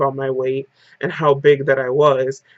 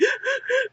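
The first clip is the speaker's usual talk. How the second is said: gasp; 8 dB quieter than usual. Retold or imitated. Retelling her crying fit.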